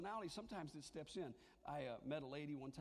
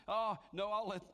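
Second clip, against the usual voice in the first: louder, brushing-off excuse; mock churchgoer ducking duty; dodging excuse; 6 dB louder than usual. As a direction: pass the buck with a shrug. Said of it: "he didn't say".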